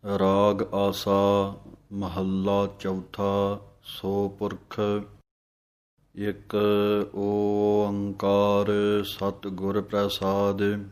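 slow, measured scripture recitation. Acted. Teacher reciting the shabad line by line for students, slow and measured. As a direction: recite each line slowly and reverently.

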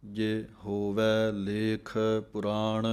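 ਪੁਰਾਣ said with one short sound on ਪੁ, not ਪੂਰਾਣ or ਪ੍ਰਾਣ; measured recitation with ਪੁਰਾਣ as ਪੁ-ਰਾਣ; slow scripture recitation. Shown as a demonstration. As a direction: slowly chant ਹੋਵੈ ਲੇਖ ਪੁਰਾਣ and keep the ਪੁ in ਪੁਰਾਣ short. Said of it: "ਪੱਪੇ ਦੇ ਥੱਲੇ ਇੱਕ ਔਂਕੜ ਹੈ, ਇੱਕ ਹੀ ਆਵਾਜ਼ ਕੱਢਣੀ ਹੈ".

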